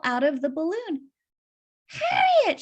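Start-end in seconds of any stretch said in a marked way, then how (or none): 1.9-2.6 high, loud cry of surprise; surprised cry. Acted. Hen recognizing her friend, high surprised cry. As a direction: gasp out the name in shock. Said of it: "she cried".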